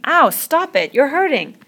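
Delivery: high, loud pained protest; cry of pain. A narrator's reading. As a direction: cry out for them to stop. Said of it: "then in real pain".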